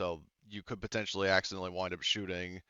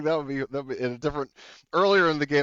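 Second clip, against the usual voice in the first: usual speaking voice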